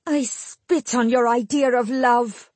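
high, slow, bitter contempt; hateful scorn. A narrator's reading. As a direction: spit it out slowly with hatred. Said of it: "felt her hatred burning in her mouth".

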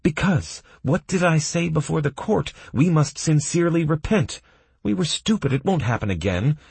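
high, agitated prisoner voice; indignant prisoner. Acted. Prisoner berating Sword over his courtroom speech, indignant.

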